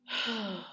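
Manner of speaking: real heavy sigh. Real, not acted. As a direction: let out a long, weary breath. Audible sigh while venting.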